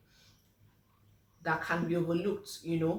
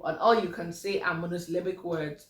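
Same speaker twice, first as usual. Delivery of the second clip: ordinary delivery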